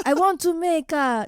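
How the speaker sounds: tentative fake accent drifting into French; Guillermo del Toro. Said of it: "That was a little French".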